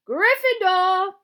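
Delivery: high, drawn-out full shout. A narrator's reading. The Sorting Hat shouts out the house, loud drawn-out shout. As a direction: bellow it long and high across a hall. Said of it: "the hat shouted".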